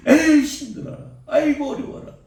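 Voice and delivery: hammed-up whining about effort; mock whining. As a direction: sigh it out like a tired believer. Mocking lazy believer's complaints.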